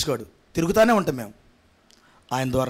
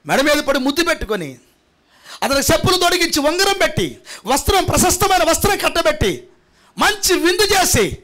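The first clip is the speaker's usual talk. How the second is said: full-force preaching; 9 semitones higher than usual. Acted. Pastor rousing the congregation, building to a shouting preach.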